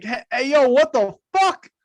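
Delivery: rising outraged call of a random cookout guy. Retold or imitated. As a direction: play the guy at the cookout who just saw something wild. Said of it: "that guy at the cookout who's like".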